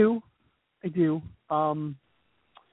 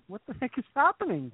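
mom voice, higher; 7 semitones higher, 5 dB quieter than usual. Retold or imitated. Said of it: "She responds with".

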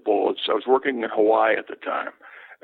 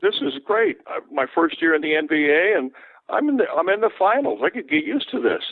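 excited; 6 semitones higher than usual. Retold or imitated. Recalling his own excited thought.

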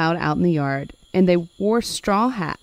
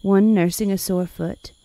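ordinary delivery

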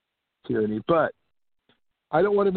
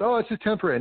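higher, sing-song dismissive oh; mocking what authorities kept saying, higher sing-song; mocking parody; 8 semitones higher, faster than usual. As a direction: parody the officials' reassurance. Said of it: "I remember them saying".

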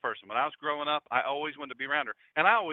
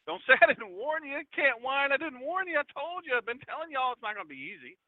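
high-pitched mock nagging; mock I-told-you-so; 12 semitones higher than usual. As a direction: scold in a high, comic plaintive voice. Mock warning in paraphrased scripture.

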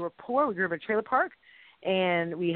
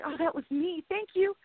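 grateful mom; 7 semitones higher, 3 dB quieter than usual. Retold or imitated.

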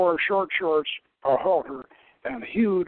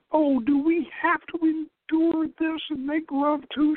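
high, loud gasping complaint; breathless high cry against the desert heat; 13 semitones higher, 4 dB louder than usual. Acted. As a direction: gasp it out, overwhelmed by the heat. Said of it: "she gasped".

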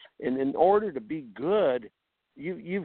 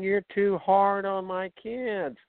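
mock complaining parent; 7 semitones higher, slower than usual. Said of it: "just complaining".

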